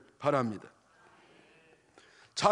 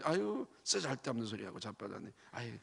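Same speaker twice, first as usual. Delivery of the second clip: contemptuous scoff; 6 semitones lower, 8 dB quieter than usual. Retold or imitated. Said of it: "째려보면서 부정적인 사람은 그러지".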